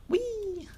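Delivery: high squealed whee while jumping; delighted high-pitched squeal; gleeful whee. Real, not acted. Creature jumping off a ledge.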